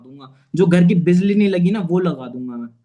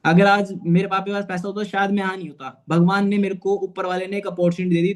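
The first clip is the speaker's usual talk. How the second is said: usual speaking voice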